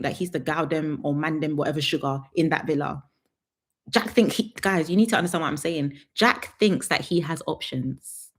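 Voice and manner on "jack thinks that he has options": slow and deliberate. Shown as a demonstration. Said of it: "slow emphatic delivery".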